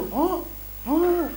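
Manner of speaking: high, mock startled What?; imitating shock. Preacher mimicking startled people, mock surprise. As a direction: play a shocked stranger, half-joking. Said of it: "some people".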